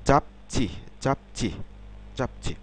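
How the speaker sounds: চাপচি with a closed p then tense chi; চাপচি in two crisp syllables. Shown as a demonstration. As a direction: stop on the p of চাপচি, then hard ch. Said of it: "ঠিক তেমনিভাবে".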